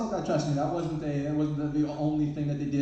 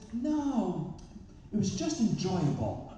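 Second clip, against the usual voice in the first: contrarian correction; 4 semitones higher, slower than usual. Retold or imitated. Oppositional man correcting again.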